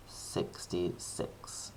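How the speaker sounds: slow hushed confirmation; slow whisper. Real, not acted. Teacher confirming the angle to students in a slow whisper. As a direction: breathe it out slowly for emphasis.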